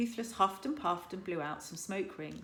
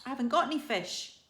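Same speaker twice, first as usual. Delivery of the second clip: Hiccup in Dragonese; 7 semitones higher, 6 dB louder, faster than usual. A narrator's reading. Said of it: "said Hiccup in Dragonese".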